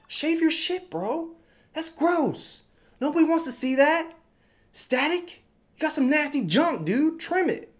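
grossed-out high-pitched jab; mock disgust. Real, not acted. Mocking the commenter back.

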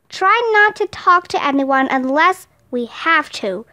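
ordinary delivery, nothing marked

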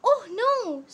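lightly acted alarm; mild alarm. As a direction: voice a pancake's mild dismay. Pancake's alarmed cry in story.

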